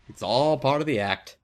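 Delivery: ordinary talk, nothing marked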